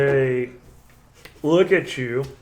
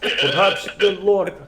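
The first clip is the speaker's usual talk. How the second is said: slow, higher mock Russian accent; 5 semitones higher, 4 dB louder than usual. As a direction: try a hammy Russian voice. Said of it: "I can do a Russian accent".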